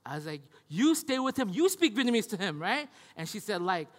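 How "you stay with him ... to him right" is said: raised voice, arguing back at grandma; pushing back hard in a car quarrel; mock argument. Son arguing with grandma in the car, raised voice.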